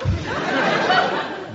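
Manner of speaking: high breathy giggle; camp giggle. Acted. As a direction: giggle coyly.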